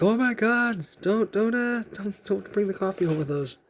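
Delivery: high fussy voice begging don't; hammed-up fretting about coffee; mock panicked reader. Viewer freaking out over the cup, mock panicked pleading. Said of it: "reading that comic".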